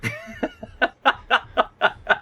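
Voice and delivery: high, sudden real laugh; burst of laughter. Real, not acted. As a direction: crack up at the absurd scene. Laughs at game scene.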